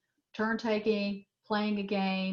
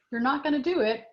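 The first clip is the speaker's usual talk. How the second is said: higher, louder, firm no; firm parent; 6 semitones higher, 4 dB louder than usual. Pretend. Parent telling the child firmly it won't happen. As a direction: set the limit plainly and firmly. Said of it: "which is telling your kid".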